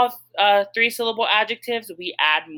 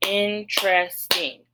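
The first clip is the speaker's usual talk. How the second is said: drawn-out slow example word; slow word demo; slower than usual. Shown as a demonstration. Teacher showing students the syllables, slow. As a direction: stretch the word out to count syllables. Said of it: "the word is interesting".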